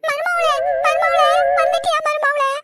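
fake lament. Mother-in-law fakes a dying lament to her daughter-in-law. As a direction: wail like a dying old woman, overdone. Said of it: "உட்காந்து ஒப்பாரி வச்சுன்னு இருக்கு".

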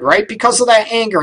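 ordinary talk, nothing marked

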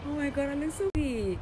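high, fast and thrilled; excited self-admiration; delighted. Real, not acted. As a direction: gush about how pretty you look. Admiring her new look.

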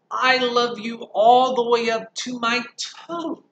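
high, loud, slow; triumphant little upside-down boast; eager little bunny; little hare. Acted.

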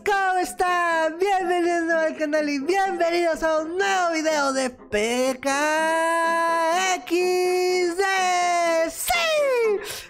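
upbeat channel intro announcer; youtuber intro. Acted. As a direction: hype up the viewers at the start.

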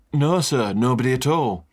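Butler answering. Acted.

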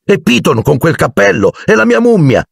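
high, rising eagerness; excited chatter. A narrator's reading. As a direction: pile on with mounting high-pitched excitement. Excited pupils boast after class. (chiacchiera eccitata, sempre più acuta)

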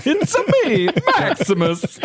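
very high, loud cartoon plumber voice; comic falsetto catchphrase; Mario. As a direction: squeal it like a video-game hero. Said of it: "using the Mario voice".

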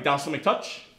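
ordinary delivery

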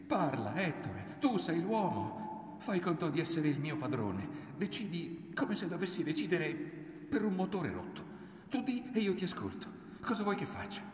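high, insistent plea climbing as it goes; desperate urging. A narrator's reading. Woman goading her silent lover, desperate high plea. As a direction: push him to decide, pitch rising to a peak. She cries at him not to cower.

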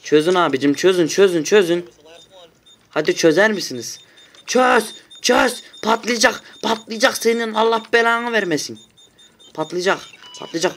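begging a teammate to defuse before it blows; frantic pleading. Real, not acted. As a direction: beg the bot to defuse in a rush. Begging bot to defuse bomb.

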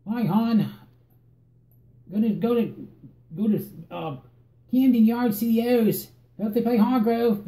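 put-on high, loud Baltimore hon accent. Mocking how people talk in Baltimore, Baltimore accent. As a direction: ham up a Baltimore local's accent. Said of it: "their accent's like".

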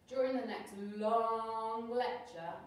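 long, slow, sing-song drawl; slow drawn-out. A narrator's reading. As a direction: stretch it out like a dull lecture. Drawn-out boring lecture narration.

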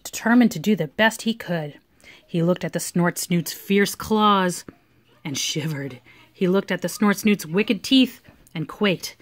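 plain reading voice

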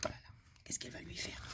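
hushed suspense whisper. A narrator's reading. Storyteller whispering suspense to the children. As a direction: whisper the question, full of suspense.

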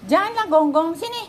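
scolding. Real, not acted. Scolding barking dogs.

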